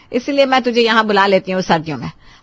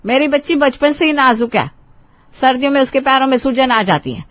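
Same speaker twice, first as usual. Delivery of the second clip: loud, high protective mother-in-law; outraged elder, pitched up and loud; indignant scolding; 5 semitones higher, 6 dB louder than usual. Acted. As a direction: scold her sharply for burdening your daughter.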